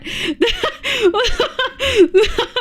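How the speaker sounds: high giggle of embarrassment; embarrassed giggle. Real, not acted. Streamer giggling, embarrassed after forgetting she can't read names. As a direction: laugh at your own slip-up.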